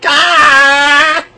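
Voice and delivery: short high put-on yell of frustration; mock scream. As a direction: let out a fake frustrated scream. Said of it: "I feel like screaming".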